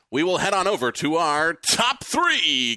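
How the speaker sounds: slow, stretched-out show-host build; drawn-out segment announcement. Pretend. Host introducing the top three questions, drawn-out announcer style. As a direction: draw out the segment title grandly.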